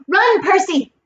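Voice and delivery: high, urgent cry; shouted warning. A narrator's reading. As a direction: call out high to send him running. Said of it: "Annabeth's voice shouted".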